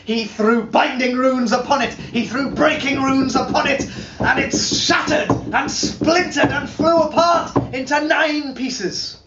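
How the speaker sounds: high, loud, triumphant proclaiming; climactic shout. Acted. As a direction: hurl the words like casting a spell. Spell shatters the serpent.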